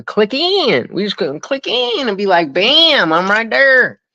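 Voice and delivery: goofy hyped-up delivery; silly talk. Pretend. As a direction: stretch it out goofily, pitch climbing. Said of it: "Why am I talking like that".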